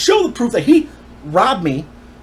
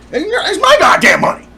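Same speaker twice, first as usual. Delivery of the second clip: high, loud mock outburst; imitating angry dad; 12 semitones higher, 6 dB louder than usual. Son imitating dad arguing over the sale money, angry yell. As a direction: act out dad yelling in outrage.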